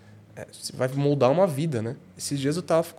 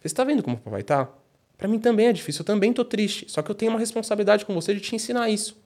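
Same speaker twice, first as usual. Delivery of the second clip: retold emotional appeal; 7 semitones higher than usual. Father retells explaining discipline to his son, mildly emotional. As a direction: pitch climbing, mildly emotional. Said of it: "eu tava emocionado na hora".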